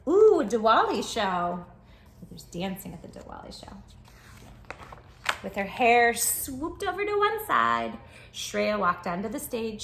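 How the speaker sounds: plain reading voice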